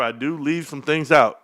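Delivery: usual speaking voice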